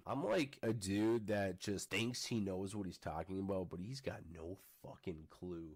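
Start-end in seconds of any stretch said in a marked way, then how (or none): none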